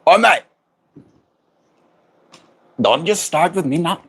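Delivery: high mock-Aussie protest; badly faked Australian twang; Australian accent. Streamer joking with the chat about Aussies, mock Australian accent. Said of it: "I can't do an Australian accent".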